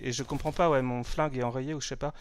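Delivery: usual speaking voice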